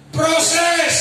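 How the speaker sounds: preaching shout. Real, not acted. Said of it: "preacher proclaiming".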